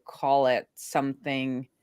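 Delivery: usual speaking voice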